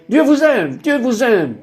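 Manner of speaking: high-pitched, joyful mock shout; retold crying out. Speaker runs into the kitchen to the family, retold crying out. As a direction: call it out high, without full volume. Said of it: "je criais".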